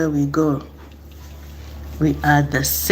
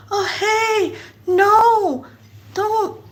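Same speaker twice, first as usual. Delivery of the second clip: put-on half-hearted sweet plea to a toddler; feeble gentle warning; much slower than usual. Adult warning kid about to run, high babying voice. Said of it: "you're just like".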